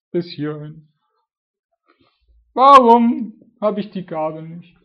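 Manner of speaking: high, loud, whiny complaining believer; hammed-up moaning prayer; mock whining. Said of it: "Ne? So ein, und Gott sagt so, „Hör doch mal auf zu jammern".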